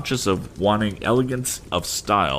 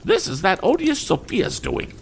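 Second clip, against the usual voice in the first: much higher, worked-up lady; emotional lady. Acted. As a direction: fling the blame in a huff. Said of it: "much amiable emotion".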